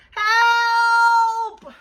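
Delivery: high, long, full-volume howl. A narrator's reading. Two trapped girls to the babysitter, howling. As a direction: howl it out, stretching the vowel. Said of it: "they howled".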